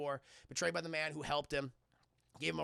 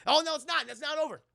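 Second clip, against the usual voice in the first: usual speaking voice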